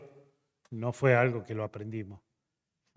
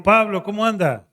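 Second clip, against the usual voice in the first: higher and louder, a bright hello to a visitor; cheery greeting; 10 semitones higher, 11 dB louder than usual. Retold or imitated. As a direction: greet him warmly and loudly.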